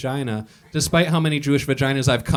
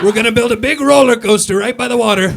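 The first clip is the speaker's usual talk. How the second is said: higher and louder, hammed-up boastful leader; mock Trump voice; 7 semitones higher, 6 dB louder than usual. Comic imitating Trump promising a roller coaster, hammed-up. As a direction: play the blustering president promising a ride.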